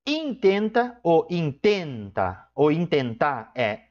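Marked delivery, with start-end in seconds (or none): 0.0-2.5 intenta drawn out slowly, stressing the in; stressed syllable demo. Shown as a demonstration. Teacher showing students the stressed syllable, slow and drawn out. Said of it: "sílaba tônica".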